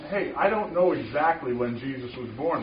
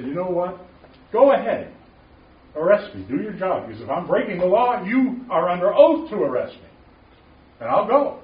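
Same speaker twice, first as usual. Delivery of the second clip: raised, loud challenge to the police; daring them to arrest him, voice up; defiant challenge; 6 semitones higher, 7 dB louder than usual. Retold or imitated. Retold dare to the officers, loud and defiant.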